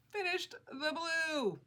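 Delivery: high, slow, sing-song "Finished the blue"; delighted repeat. Real, not acted. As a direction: savour it like a small victory. Proud of finishing the blue section.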